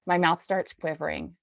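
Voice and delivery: ordinary delivery, nothing marked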